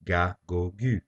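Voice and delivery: "Ga, go, gu" each with a hard G as in go; hard G syllables. Shown as a demonstration. Teacher demonstrating the hard G before a, o, u. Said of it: "is pronounced as G".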